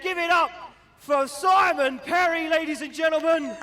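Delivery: stretched ringside bellow; announcer shout. Real, not acted. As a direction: drag out the name, loud. Announcer introducing drivers.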